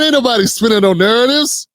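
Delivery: ordinary delivery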